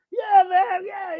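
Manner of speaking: high, loud cheering yell; mock elation. Storyteller playing along with the drunk, high mock cheer. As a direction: whoop along at full pitch. Acted elated exchange with drunk.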